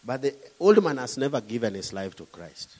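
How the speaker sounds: normal talking voice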